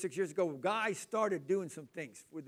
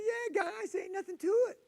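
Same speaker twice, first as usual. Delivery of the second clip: high, breezy brag to the guys; cocky boast; 4 dB louder than usual. Retold or imitated. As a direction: shrug it off like it's nothing. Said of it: "I said".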